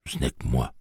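lightly voiced little gruff engine; a hint of gruffness, owning up; little train gently gruff; small gruff voice. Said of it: "dit la petite voix bourrue".

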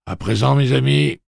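battle yell. Acted. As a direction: yell them into the charge. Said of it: "hurle la dernière douzaine d'hommes".

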